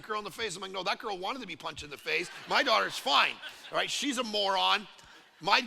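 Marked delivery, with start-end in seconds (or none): none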